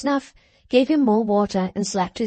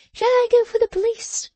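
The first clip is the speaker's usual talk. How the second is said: much higher, bright offer with a nimble start; eager little dressmaker; 14 semitones higher than usual. Acted. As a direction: tease him with a helpful offer. Jenny's voice.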